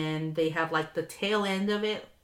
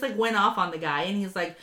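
ordinary talk, nothing marked

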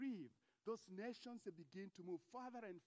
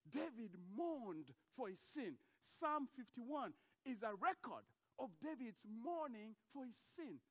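usual speaking voice

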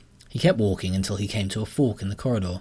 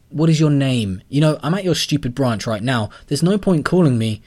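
loud, higher irritated demands; angry; 5 semitones higher, 7 dB louder than usual. A narrator's reading. Callum scolds the caller at the branch, loud. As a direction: snap at the bank caller.

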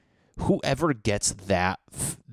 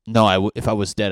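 exasperated outburst; 9 dB louder, faster than usual. Acted. Acted argument, returned husband.

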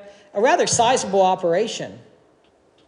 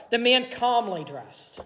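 calm; 2 semitones higher, 7 dB quieter, slower than usual. A narrator's reading. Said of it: "He calmly dressed".

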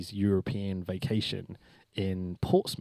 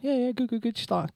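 lightly voiced wrestler, pitched higher, breezy and casual; a light take on a friendly wrestler's quick hello, higher up; Jimmy Havoc impression; 11 semitones higher, 3 dB quieter than usual. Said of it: "that's my Jimmy Havoc impression".

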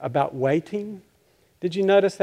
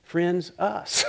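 normal talking voice

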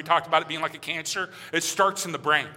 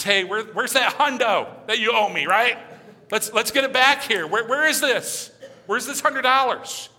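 louder, higher pushy demand for the money; demanding creditor; 5 semitones higher, 4 dB louder than usual. Acted. Creditor leaning on the debtor, demanding pushy voice. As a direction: lean on him for the hundo, pitched up.

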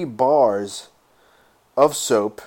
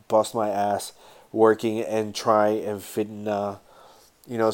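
normal talking voice

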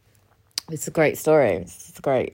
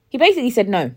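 ordinary talk

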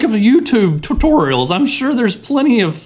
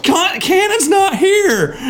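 exasperated shout; 11 semitones higher, slower than usual. Real, not acted. Host shouting at repeat caller.

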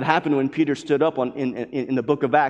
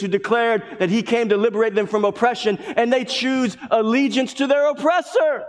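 rising preacher fervor; 6 semitones higher than usual. Acted. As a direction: build the outrage line by line. Preacher building to climax.

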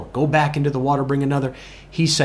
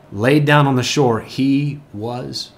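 ordinary delivery

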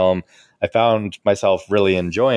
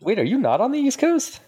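surprised. Real, not acted. Surprised by Nashville location.

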